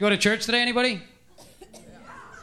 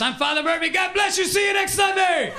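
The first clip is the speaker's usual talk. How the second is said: high, shouted rock star farewell as a priest; rock star priest sign-off; 9 semitones higher than usual. Retold or imitated. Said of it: "He closes up the mass".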